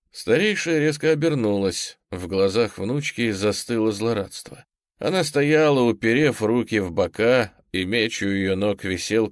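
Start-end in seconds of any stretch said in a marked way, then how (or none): none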